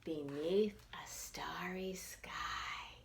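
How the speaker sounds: slow, soft whispered ending. Acted. Narrator ending the bedtime story in a whisper. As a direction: whisper like the child is asleep.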